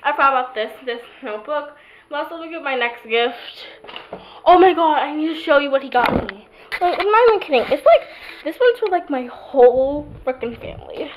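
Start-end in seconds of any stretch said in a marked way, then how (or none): 4.5-11.1 excited. Real, not acted. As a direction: burst out with delight over a gift. Excited showing a gift.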